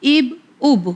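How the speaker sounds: اب repeated as a clipped single syllable; teacher drilling اب for children; syllable drilled alone. Shown as a demonstration. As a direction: say اب on its own, twice. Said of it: "اس طرح بھی ہم پڑھ سکتے ہیں اسے".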